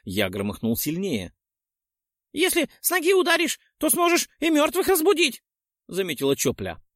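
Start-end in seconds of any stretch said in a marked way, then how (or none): 2.3-5.4 put-on high girlish voice. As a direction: lift into a young woman's voice. Чопля voiced by male narrator.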